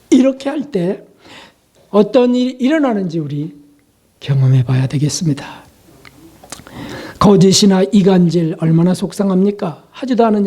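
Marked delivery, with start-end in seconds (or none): none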